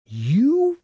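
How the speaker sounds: high, loud exasperated outburst. Acted. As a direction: sputter it out in disbelief. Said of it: "in exasperation".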